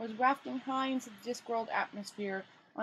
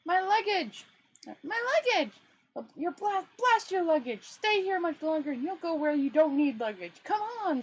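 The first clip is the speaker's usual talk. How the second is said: high, loud frantic shout; screamed; 7 semitones higher, 3 dB louder than usual. Acted. Rincewind screaming at Two Flower to flee. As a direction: scream it in a panic. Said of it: "screamed Rincewind".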